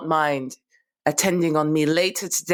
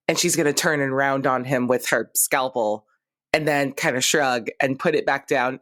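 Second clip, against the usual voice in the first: ordinary talk, nothing marked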